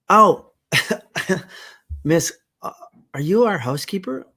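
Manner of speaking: put-on an eager child meeting someone new; excited young girl. Queenie meets Martha.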